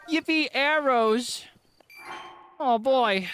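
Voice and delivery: high, loud and slow cartoon cheer; cheesy dub cheer. Cheesy dub-style pickup cheer.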